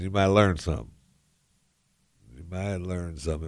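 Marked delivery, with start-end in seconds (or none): 2.4-3.5 much softer, an aside to himself; quiet echo. Acted. Host repeating advice to listeners, softly to himself. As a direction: drop the repeat to a near-murmur.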